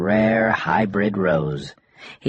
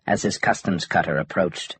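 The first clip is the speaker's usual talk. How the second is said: ordinary delivery, nothing marked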